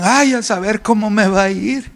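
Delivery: high, fretful complaining with sighing ays; mock hypochondriac moaning about his illness; whiny worried hypochondriac. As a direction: play a worrier sure he is dying. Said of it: "aquellos que yo conozco... dicen".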